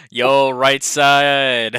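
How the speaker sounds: loud, long-stretched "yo, right side" call; rallying call. Pretend. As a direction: call out to your side, dragging out the words. Calling to own side of table.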